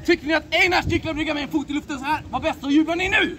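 rally the crowd to cheer; host shouting to the audience for cheers; calling to the crowd. Acted.

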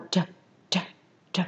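T as a puff of air, no vowel; airy T. Shown as a demonstration. Teacher showing students the wind sound, airy T. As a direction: release "t" in short bursts of air. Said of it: "âm là âm gió nha".

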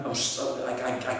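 ordinary talk, nothing marked

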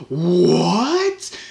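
sudden loud, high what; baffled outburst; surprised what. Real, not acted. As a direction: blurt it out in disbelief. Surprise at water-cooled Xbox.

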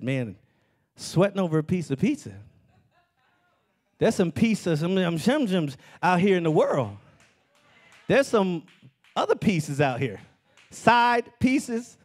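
hammed-up inner voice lusting after pizza; mock sly voice eyeing side pieces; tempted self. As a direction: play your own greedy inner voice, half laughing. Pastor voices his tempted self.

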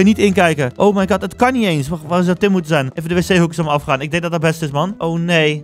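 usual speaking voice

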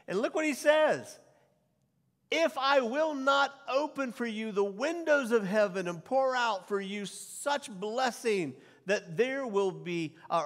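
high, loud, slowed preacher's proclamation; preaching at full force. Acted. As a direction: boom the scripture out to the room. Preacher reads Malachi at full force.

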